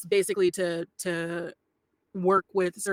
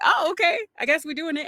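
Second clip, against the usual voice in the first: high, louder acted surprise; surprised acceptance; 9 semitones higher, 5 dB louder than usual. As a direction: go up high, taken aback but going along. Reenacts surprised reaction to booking.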